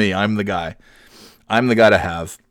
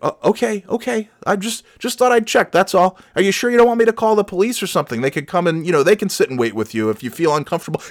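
high, fast, nervous mock politeness; timid helper voice; 8 semitones higher, faster than usual. Narrator imitates himself nervously offering to call police. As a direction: play the meek good samaritan, hurried. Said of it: "He's like".

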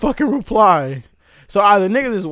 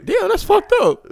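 laughing; 13 semitones higher, slower than usual. Real, not acted. Laughing reaction.

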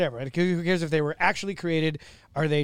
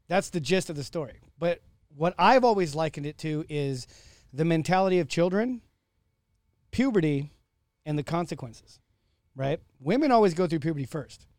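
ordinary delivery

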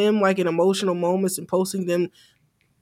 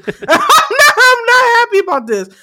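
laughing it out, much higher and louder; 10 dB louder than usual. Real, not acted. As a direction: say it through high laughter. Laughing at her own reply.

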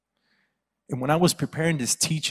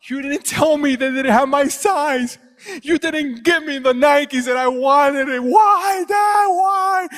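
very high, loud mock bawling; imitated crying son; 13 semitones higher, 9 dB louder, slower than usual. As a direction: wail accusingly like a child, climbing to a shriek. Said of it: "crying. He's like".

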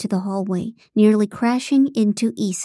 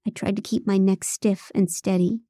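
ordinary delivery, nothing marked

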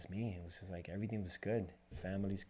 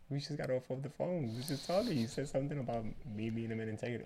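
higher, pressing disbelief, acted out; mock exasperated protest; 6 semitones higher, 4 dB louder, faster than usual. Husband reminding his wife of her word, playfully exasperated. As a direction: insist as if reminding someone who forgot. Retold protest at wife's forgetting.

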